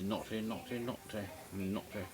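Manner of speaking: soft, hushed teasing tut-tut; quiet sing-song mock reproach; mock scolding. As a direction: wag a finger at them playfully, under your breath. Teasing about underage Cindy.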